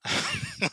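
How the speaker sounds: high-pitched, loud burst of laughter; scornful laugh. Real, not acted. Preacher laughing at the fate awaiting sinners, high scornful laugh.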